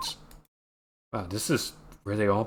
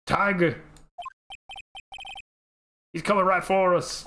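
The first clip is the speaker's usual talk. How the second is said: panicked shout; 8 semitones higher than usual. Acted. Warning friends that a tiger is charging, panicked shout. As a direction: yell the warning before it pounces.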